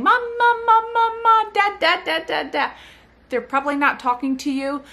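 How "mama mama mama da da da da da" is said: high, loud repetitive babble; babbling baby. Retold or imitated. Mother imitates babies babbling to nobody, high babble. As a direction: rattle off syllables like a baby. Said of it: "if they're saying".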